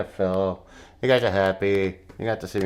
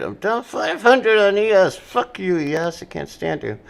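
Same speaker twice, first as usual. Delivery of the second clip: higher, louder angry rant; frustrated outburst; 9 semitones higher, 5 dB louder than usual. Real, not acted. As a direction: vent at the losing trade. Angry at losing trade.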